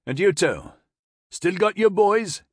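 high, loud Geordie; broad Newcastle accent. A narrator's reading. Corporal asking the major after the boys, Newcastle accent. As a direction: call the question across in broad Newcastle. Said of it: "in a broad Newcastle accent".

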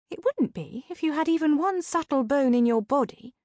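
high, chiding sidekick voice; Sabrina. A narrator's reading. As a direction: chide your partner in a high voice. Sabrina's character voice in narration.

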